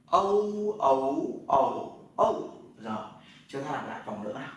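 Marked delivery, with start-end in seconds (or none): 0.0-2.6 "Âu" gliding to u, through four tones; ou read as "Âu". Shown as a demonstration. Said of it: "Âu nhá". (đọc "Âu" qua bốn thanh điệu)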